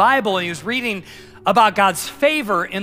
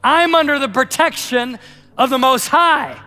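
bold declaration; 6 semitones higher, 5 dB louder than usual. Retold or imitated. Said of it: "And he goes".

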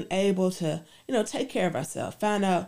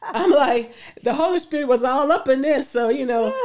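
laughing while talking fast; giddy laughing excitement; 6 semitones higher, 7 dB louder than usual. Real, not acted. Laughing about the Holy Spirit.

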